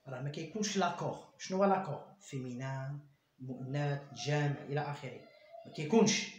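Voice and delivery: ordinary talk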